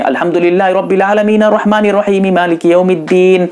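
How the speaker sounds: lightly intoned Quran recitation, imitating the imam; imam's recitation. Teacher imitating the imam starting recitation, lightly intoned. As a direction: slip into the imam's chant for a moment. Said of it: "इमाम साहब शुरू कर देते हैं".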